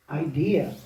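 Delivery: ordinary delivery, nothing marked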